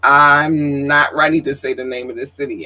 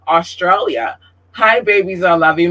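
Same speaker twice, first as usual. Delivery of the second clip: ordinary talk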